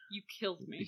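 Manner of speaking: soft, low-energy ghostly reproach; mock ghost Banquo. Banquo's ghost accusing Macbeth, mock-ghostly. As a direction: murmur it as a wounded spirit. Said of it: "Quoth the Banquo".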